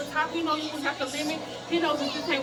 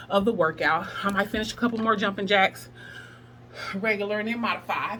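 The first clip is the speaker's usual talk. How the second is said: usual speaking voice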